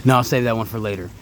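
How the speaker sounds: ordinary talk, nothing marked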